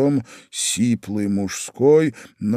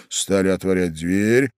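ordinary delivery, nothing marked

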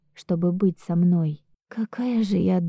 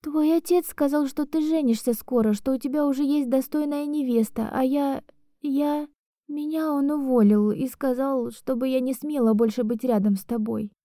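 tearful; 6 semitones higher, 3 dB quieter than usual. Acted. Crying heroine explains on phone.

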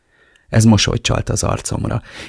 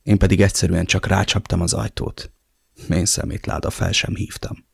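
ordinary delivery, nothing marked

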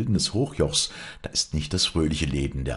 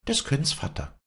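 put-on higher, proud young woman; defiant daughter; 6 semitones higher than usual. A narrator's reading. Wally refusing her father, higher defiant voice. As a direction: defy him, almost mocking.

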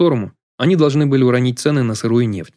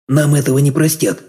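higher-pitched, mildly alarmed objection; alarmed protest; 4 semitones higher than usual. A narrator's reading. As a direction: object in dismay, pitch lifted. Stunned guest protests the plan. (встревоженное возражение)

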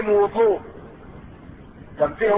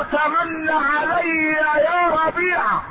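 high, drawn-out ringing call; intoned preaching; 7 semitones higher, much slower than usual. Acted. Prophet offering Rabia a wish, intoned preaching. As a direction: hold the pitch high and stretch the name.

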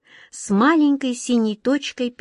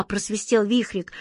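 plain reading voice

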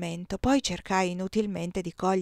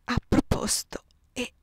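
voiceless dying whisper; whispered murmur; 4 semitones higher, slower than usual. Acted. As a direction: let the words fade into breath. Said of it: "mormorò". (sussurro senza voce di un moribondo)

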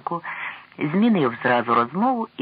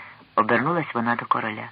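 plain reading voice

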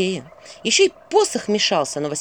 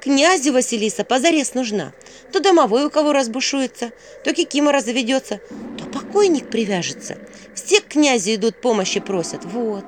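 put-on higher-pitched, emphatic girl listing; eager Vasilisa; 6 semitones higher, 3 dB louder than usual. Vasilisa persuades the owl the prince needs her, eager. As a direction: pile up the reasons with conviction.

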